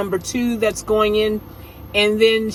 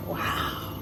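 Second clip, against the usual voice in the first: whispered wow; 12 dB quieter than usual. Real, not acted. Reacting to shirt.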